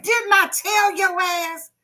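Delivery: a small kid playing tough with his toys; little boy cussing at toys. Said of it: "He's like".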